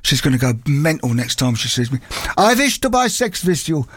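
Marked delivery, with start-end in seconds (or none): 2.4-3.9 put-on higher pantomime German accent, v for w; hammed-up German accent. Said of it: "pantomime German accent".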